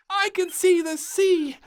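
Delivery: high, loud thrilled cry; excited exclamation. Acted. Little Princess spotting the sea, high thrilled cry. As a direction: burst out with delight. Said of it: "exclaimed little Princess".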